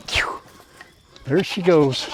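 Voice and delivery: normal talking voice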